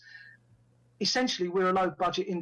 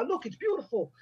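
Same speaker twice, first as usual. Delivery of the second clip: higher imitation Dutch accent; Rutger Hauer; 6 semitones higher than usual. Actor showing director the woods, imitated Dutch accent. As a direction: marvel lightly in a mimicked Dutch accent. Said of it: "And he'd go".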